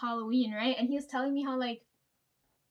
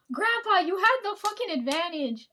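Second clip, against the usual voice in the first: higher and louder, laughing disbelief; excited outburst; 6 semitones higher, 5 dB louder than usual. Retold or imitated. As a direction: burst out at grandpa. Teller excitedly argues with grandpa.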